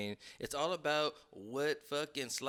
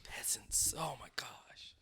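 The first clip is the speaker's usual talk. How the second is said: lower, laughing whisper; 5 semitones lower, 10 dB quieter, much slower than usual. Pretend. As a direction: hiss it like a snob. Mock villain banter.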